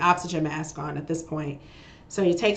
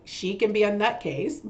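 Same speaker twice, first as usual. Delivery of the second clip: ordinary talk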